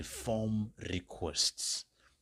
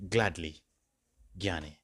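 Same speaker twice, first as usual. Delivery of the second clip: normal talking voice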